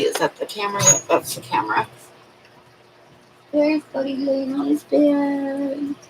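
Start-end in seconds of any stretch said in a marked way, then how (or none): 3.5-5.9 high, slow, sing-song for the dog; pet voice. Real, not acted. Talking sweetly to the dog.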